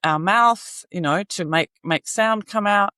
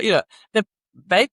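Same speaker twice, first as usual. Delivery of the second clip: normal talking voice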